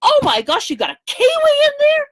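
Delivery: high, loud amazed squeal at the kiwi; surprised cowboy. Retold or imitated. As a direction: squeal it like a cowboy who can't believe his eyes. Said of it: "he goes".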